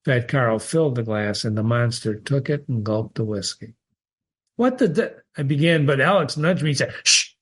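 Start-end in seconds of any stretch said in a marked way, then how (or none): 4.6-5.2 sudden high cut-off exclamation; startled outburst. Acted. Narrator startled as the monster gulps whiskey, high outburst. 7.0-7.3 short sharp shushing hiss; hushing shh. Acted. Alex hushing the narrator in the bar, sharp shh.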